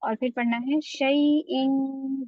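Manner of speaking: slow reading ending शैइन with a nasal held n; ikhfa demo. Shown as a demonstration. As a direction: join the tanween and hum शैइन through the nose. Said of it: "इस तनवीन को आपको यहाँ पर से मिलाना है".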